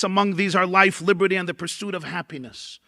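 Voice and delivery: ordinary talk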